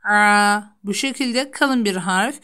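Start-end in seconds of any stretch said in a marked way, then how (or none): 0.0-0.6 loud, thick Arabic ayn on "A"; squeezed-throat ayn. Shown as a demonstration. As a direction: tighten the throat and press out "A". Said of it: "boğazımıza sıkarak çıkarıyoruz".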